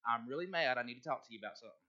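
low, quiet declaration of being mad; serious warning of anger. Shown as a demonstration. Pastor coaching Kirk how to announce anger, low and serious. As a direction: state it low and softly, in earnest. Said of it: "if you ever are mad".

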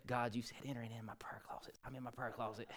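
hushed unvoiced prayer; whisper. Preacher hiding in his prayer closet, whispering to God. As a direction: whisper it so nobody hears.

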